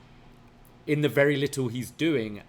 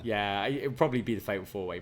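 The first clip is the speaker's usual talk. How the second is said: normal talking voice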